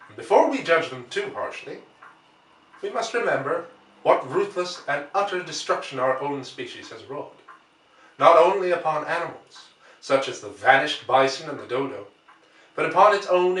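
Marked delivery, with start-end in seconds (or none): none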